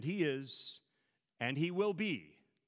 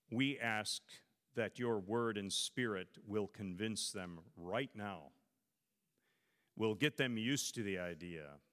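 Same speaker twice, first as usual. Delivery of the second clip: prayer; 5 semitones lower than usual. Acted. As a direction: pray humbly for the congregation. Leads a closing prayer.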